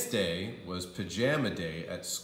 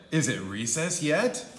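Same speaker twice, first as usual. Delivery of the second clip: put-on bright eager kid; 5 semitones higher, 4 dB louder than usual. A narrator's reading. Andrew wakes up.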